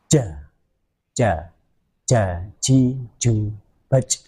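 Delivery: "ja, ji, ju, baj" with the sound stopped sharply; Arabic jim with a cut-off sound; jim drill with syiddah. Shown as a demonstration. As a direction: sound out the jim with a held stop. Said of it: "Syiddah itu tertahannya suara".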